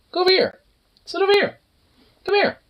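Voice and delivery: high-pitched, urgent call to a dog. Real, not acted. Calling the dog over.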